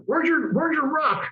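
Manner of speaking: a Southern commander asking, slow and pointed; southern accent. Retold or imitated. Commander demanding the pilot's ruck, slow southern accent. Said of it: "he had this southern accent".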